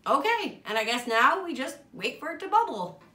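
face covered by wet mask; through a sheet mask. Real, not acted. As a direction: lips stiff under mask.

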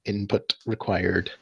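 flat robotic monotone. Acted. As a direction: beep boop like a machine. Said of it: "her voice is sort of robotic".

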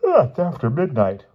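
high, loud yawn into the words; sleepy yawned complaint. A narrator's reading. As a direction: talk through a big yawn. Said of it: "Bruno yawned".

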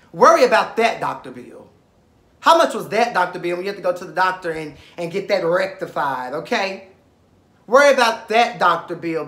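sneering loud taunt at a rival; taunting clapback. Real, not acted. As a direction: spit out the taunt, drop on the name. Clapping back at Markel.

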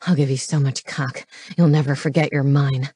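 low, possessive lover's voice; low-voiced man in passion; panting male lover. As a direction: drop well down in pitch, claiming her. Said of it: "he panted out between harsh thrusts".

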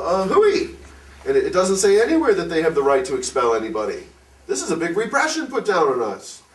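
rile up like a man who's had enough; excited indignant outburst, pitched well up; agitated objection. Retold or imitated.

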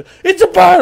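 very high, loud sudden yell; hammed-up scream at a passer-by; startling shout. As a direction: blast it out half laughing. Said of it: "It probably scared me".

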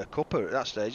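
normal talking voice

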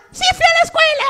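very high, loud puppet answer; squeaky dummy shouting happily; talking doll. Pretend. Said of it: "¿fuiste a la escuela?".

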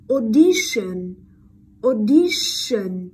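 audition said loud and slow with a long sh. Shown as a demonstration. Teacher models for learners, audition slow and loud with long sh. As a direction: stretch the SH in "audition". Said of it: "vẫn là âm sh".

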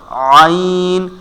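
normal talking voice

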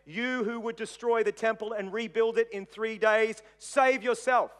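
lightly acted crowd taunting a victim; mocking taunt. A narrator's reading. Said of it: "they mocked him, saying".

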